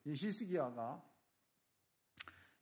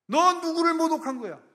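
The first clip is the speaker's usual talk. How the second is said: much higher and louder, 너 thrown hard; furious shout; 13 semitones higher, 13 dB louder than usual. Acted. God shouting at the king who mocked Him. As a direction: let the rebuke explode. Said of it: "사람의 음성처럼 듣는 거예요".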